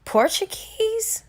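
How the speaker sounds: high, slow amazed question; jaw-dropped disbelief; stunned. Acted. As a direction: gasp it out in disbelief. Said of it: "Corinthian's mouth dropped".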